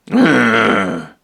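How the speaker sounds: low, strange grunt like a dim creature; a low monster ugh. Acted.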